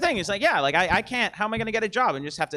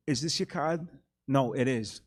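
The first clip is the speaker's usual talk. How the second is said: Christopher Walken; 6 semitones lower, 6 dB quieter than usual. Pretend. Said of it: "this is Christopher Walken doing magic".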